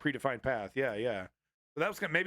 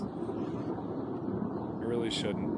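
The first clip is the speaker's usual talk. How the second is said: normal talking voice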